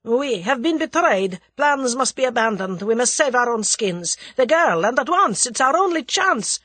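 high, loud urgent warning; urgent alarm. A narrator's reading. The Russian warns Whittington they are betrayed, urgent alarm. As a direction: press for action, pitch climbing.

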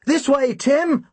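high, drawn-out call; shouted. A narrator's reading. Narrator calling Tim while fleeing the killers, shouted. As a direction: call out urgently, pitched well up. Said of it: "I shouted".

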